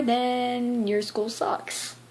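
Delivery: slow, drawn-out words; telling viewers their school is bad, slow deadpan; deadpan punchline. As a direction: drag every word for effect.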